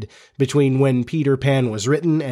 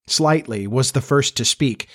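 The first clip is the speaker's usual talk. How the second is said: ordinary delivery, nothing marked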